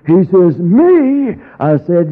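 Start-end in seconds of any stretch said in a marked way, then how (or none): 0.6-1.4 high, louder indignant "Me?"; indignant surprise. Retold or imitated. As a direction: sound taken aback. Indignant question.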